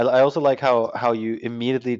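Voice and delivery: ordinary delivery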